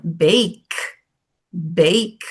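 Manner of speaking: bake with a long closed vowel and a bare final k; teacher modelling the word bake; English pronunciation. Shown as a demonstration. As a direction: end bake on a clean k, no vowel after. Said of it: "Olha outra palavra".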